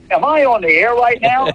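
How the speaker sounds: high-pitched, incredulous question; mock-stunned, pitch jumping up; retold disbelief. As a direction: act out suddenly realizing you may be live. Retells his shocked realization on air.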